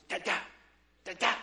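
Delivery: imitating a baby's insistent babble; fervent scowling baby. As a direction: babble in short, scolding bursts with a scowl. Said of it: "she gets a scowl, and she goes".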